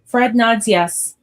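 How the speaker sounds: usual speaking voice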